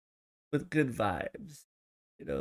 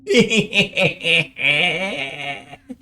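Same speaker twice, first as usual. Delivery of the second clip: loud, high, long cackle; put-on horror-host cackle, stretched out; hammed-up spooky cackle; 6 semitones higher, 11 dB louder than usual. Host opening the ghost game, hammed-up spooky cackle. Spooky laugh after title.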